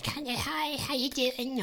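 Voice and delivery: high, soft croaky smoker dog; Penny. Acted. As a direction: croak it high like too many cigarettes. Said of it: "little croaky, little smoke too many cigarettes".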